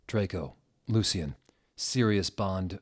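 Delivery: ordinary delivery, nothing marked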